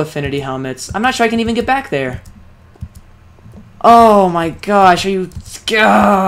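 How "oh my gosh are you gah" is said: loud, slow, exasperated groan of anger; real angry outburst. Real, not acted. Gamer furious at dying, loud angry groan. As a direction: let the frustration burst out loud and drawn. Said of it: "I'm so angry right now".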